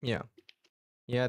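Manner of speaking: ordinary talk, nothing marked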